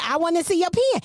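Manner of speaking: high insistent PEN as "pee-in"; country pen. Retold or imitated. Host insisting to coworker, high, PEN as "pee-in". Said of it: "It was country pen".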